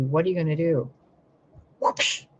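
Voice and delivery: ordinary talk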